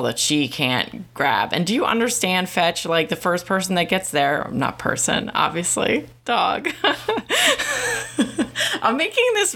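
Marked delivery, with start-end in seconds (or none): 6.2-9.6 high burst of real laughter breaking out; laughing. Real, not acted. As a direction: crack up at your own slip. Laughs at own slip.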